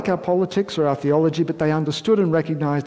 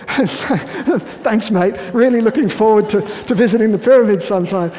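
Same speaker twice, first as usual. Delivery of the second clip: mock cheerful reply; 5 semitones higher, 5 dB louder than usual. Sarcastic reply to his jailer.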